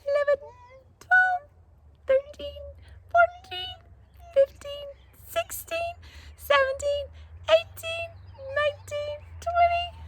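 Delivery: very high chirpy bird counting; little bird voice. Shown as a demonstration. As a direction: squeak the numbers like a tiny bird. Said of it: "let's be a little bird".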